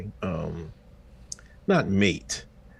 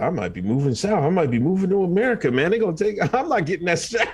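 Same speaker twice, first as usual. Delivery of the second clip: retold Canadian plan; 6 semitones higher, faster than usual. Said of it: "He said".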